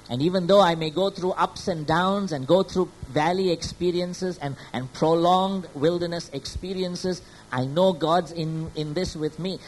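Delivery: loud, rising preacher's voice driving each point home; preaching at full force. Acted. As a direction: preach it out to the congregation with fervour.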